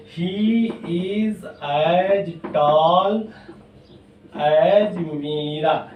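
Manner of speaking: very slow, word-by-word dictation; slow board dictation; dictating slowly. Shown as a demonstration. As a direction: spell it out for the class to copy. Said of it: "teacher dictates example sentence".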